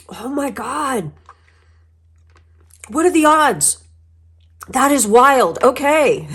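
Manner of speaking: high excited disbelief; amazed exclamation. Real, not acted. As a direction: gasp it out in wonder. Amazed by the odds.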